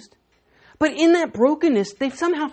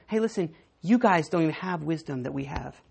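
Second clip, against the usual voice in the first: ordinary delivery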